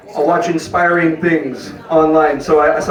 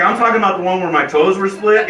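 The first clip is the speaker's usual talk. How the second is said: ordinary delivery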